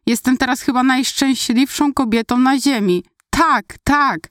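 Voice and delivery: high-pitched burst of happiness; overjoyed cry. A narrator's reading. Fiancée accepts the proposal late at night, overjoyed cry. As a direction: cry yes, overcome with joy. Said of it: "krzyczałam, nie przejmując się".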